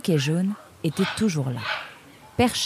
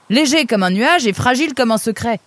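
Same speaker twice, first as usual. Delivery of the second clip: excited; 6 semitones higher, 5 dB louder than usual. Acted. Solution de charade déclamée gaiement.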